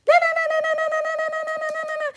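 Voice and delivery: high, loud sing-song taunting na-na; mock the haters in a squeaky childish chant. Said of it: "These people".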